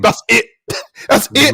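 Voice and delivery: high laughing repeat. Real, not acted. Laughing tag line.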